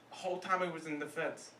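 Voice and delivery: ordinary talk, nothing marked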